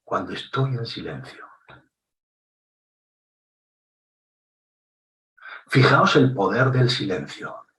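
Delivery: long weighty silences between phrases; dramatic pauses. Shown as a demonstration. Speaker showing the audience the power of a pause, long silences. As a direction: let each line hang in silence before the next. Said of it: "Fijaos cómo remarco una idea cuando me callo".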